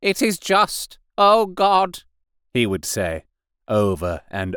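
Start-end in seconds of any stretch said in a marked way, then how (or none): 0.0-2.0 high, raised voice crying out in repentance; loud lament to God. A narrator's reading. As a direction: call out to God like a raving curate. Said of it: "he began to talk aloud instead of whispering".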